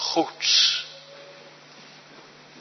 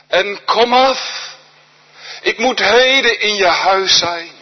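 raised, urgent call from below; 5 semitones higher than usual. Acted. Jesus calling Zacchaeus down. (roepend en dringend, luider en hoger)

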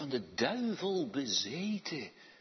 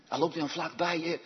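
usual speaking voice

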